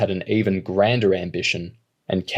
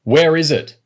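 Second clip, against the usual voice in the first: high, loud demand; yelled; 7 semitones higher, 5 dB louder than usual. Acted. Robber yelling at the woman for her purse. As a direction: shout the question at her. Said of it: "Wheels yelled".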